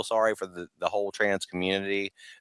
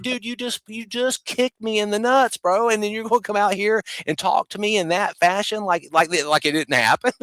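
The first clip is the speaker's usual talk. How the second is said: high, indignant retort; mock outrage; 10 semitones higher than usual. A betrayed supporter scolding Kennedy, high and outraged. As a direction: throw it back at him, incredulous.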